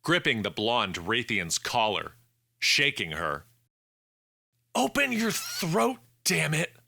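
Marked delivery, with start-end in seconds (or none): 4.7-6.8 urgent command. A narrator's reading. Hannah shaking suffocating woman.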